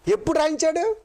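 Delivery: high, rising challenge on రాయించాడు; rising question. Shown as a demonstration. Preacher quizzing the congregation with a high rising question. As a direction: toss the question up high to the crowd. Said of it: "చూసారు ఎంత జాగ్రత్త".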